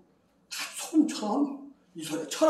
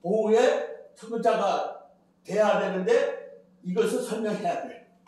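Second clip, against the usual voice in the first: ordinary talk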